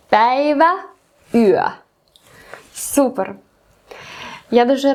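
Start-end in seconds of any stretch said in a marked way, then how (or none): 0.1-1.7 Päivä and yö, louder and clearly Finnish. Shown as a demonstration. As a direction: model Päivä and yö. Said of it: "І шосте, це фінські літери". (Päivä, yö по-фінськи, голосніше)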